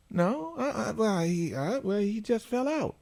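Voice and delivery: high, hesitant mock mom; imitating the mother. Mom fumbling for an answer, hesitant high voice. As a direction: stammer as the caught-out mother, high.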